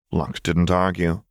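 ordinary delivery, nothing marked

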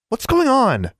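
high-pitched, insistent question; demanding. A narrator's reading. Dragged-along roommate wanting an explanation, demanding. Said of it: "Anna demanded".

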